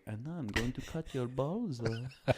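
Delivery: put-on slow, hushed German accent; soft, menacing put-on German accent; mock German accent. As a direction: parody a German movie lover, softly and slowly. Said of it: "a German love movie is kind of like".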